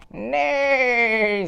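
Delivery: very high neighing cry; whinny it like a horse. Acted. Reading the horse's line, high neigh.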